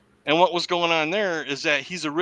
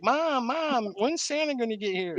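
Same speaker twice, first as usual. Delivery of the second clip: put-on little girl; 8 semitones higher, 4 dB quieter than usual. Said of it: "her daughter's like".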